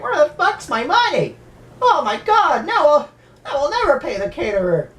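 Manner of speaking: high, strained mock panic over money; frantic Jimmy. Jimmy panics about his missing money, high mock whine. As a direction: whine it like a man robbed before his wedding. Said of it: "Jimmy's just like".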